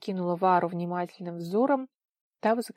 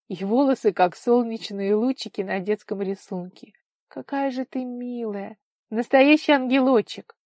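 higher, tender gushing, growing louder; touched, doting; 3 semitones higher, 7 dB louder than usual. A narrator's reading. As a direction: dote on her with rising delight. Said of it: "умилилась она". (умилённо, ласково, всё восторженнее)

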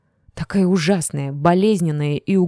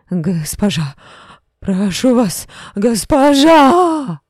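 rising to a very high, very loud, slow wail; drunk's plea becomes a drawn-out scream; drawn-out cry; 6 semitones higher, 7 dB louder, much slower than usual. A narrator's reading. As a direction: plead that turns into a long scream. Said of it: "стало протяжным криком".

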